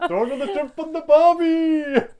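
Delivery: loud, high, drawn-out bad Australian accent; terrible Australian accent. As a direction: shout it in a hammed-up Aussie accent. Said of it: "my terrible accent".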